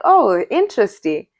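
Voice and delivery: usual speaking voice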